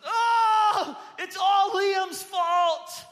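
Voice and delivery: put-on high, loud wailing yell of a kid; mock a crying boy's shout of blame; imitating a yelling child. Hurt son blaming his brother, mock wailing yell.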